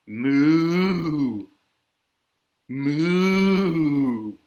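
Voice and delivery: long drawn-out cow moo. Acted. Reader shows little kids a cow, long mooing. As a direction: moo like a cow, swelling and falling. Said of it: "He can sound like a cow".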